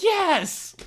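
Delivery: high, loud thrilled cheer; excited yes. Retold or imitated. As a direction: celebrate being recognized. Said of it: "They're like".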